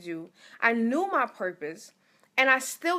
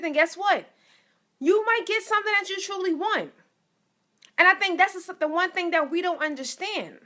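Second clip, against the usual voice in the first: higher and louder, building fervor; preaching; 5 semitones higher, 4 dB louder than usual. Acted. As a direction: preach it to the congregation, rising.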